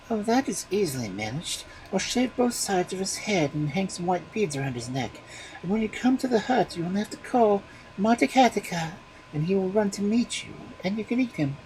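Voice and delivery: high wife voice. A narrator's reading. Wife explaining to the ogre in a high voice.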